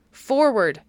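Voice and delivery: narrated baron's rallying call; commanding cry. A narrator's reading. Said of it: "cried the Baron Henry".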